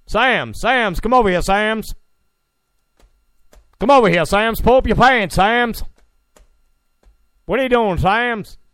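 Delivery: high, loud stern principal calling a student; Joe Clark impression. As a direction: bark at the kid like Crazy Joe Clark. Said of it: "He sounded like Joe Clark".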